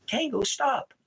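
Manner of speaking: high call to the dog. Real, not acted. Scolding his dog Tango with a high call.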